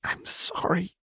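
soft breathy high whisper; whispered apology. Acted. Jinx whispers an apology to Warwick. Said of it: "Jinx whispered".

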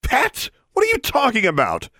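high-pitched indignant outburst; rising anger. A narrator's reading. Girl stung at being called a pet, rising anger. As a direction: let the voice jump up in anger. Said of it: "Her voice rose angrily".

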